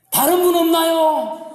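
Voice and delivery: high, drawn-out comic call for someone else; acted calling out. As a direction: holler hopefully past the first answer. Dangling man calls for someone else. (높고 길게 외쳐 부르는 익살스러운 연기)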